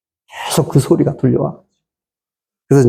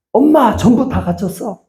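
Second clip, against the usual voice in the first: mildly alarmed retold cry, higher; trapped daughter's cry; 7 semitones higher than usual. Daughter tells mother she is trapped in fire, mild alarm. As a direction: imitate a daughter calling out, pitched up.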